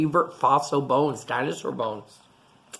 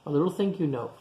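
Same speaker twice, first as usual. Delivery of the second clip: ordinary delivery, nothing marked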